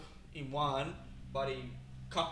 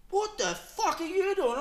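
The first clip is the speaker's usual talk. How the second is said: much higher, slow, loud; mock angry yell; 4 dB louder, slower than usual. The culler shouting at the driver, mock angry yell. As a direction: yell it like a startled shooter. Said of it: "He's, like, turning in his".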